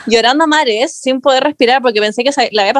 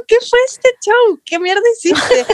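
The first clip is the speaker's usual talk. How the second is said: retold incredulous outrage; 11 semitones higher than usual. Girlfriend retelling how she confronted her boyfriend, in high incredulous outrage. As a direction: re-enact demanding an explanation, pitch jumping up.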